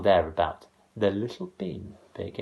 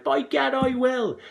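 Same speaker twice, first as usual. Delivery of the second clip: high, loud defiant cry; mother vowing to climb; determined mother; 5 dB louder than usual. A narrator's reading. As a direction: declare it boldly. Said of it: "The mother cried".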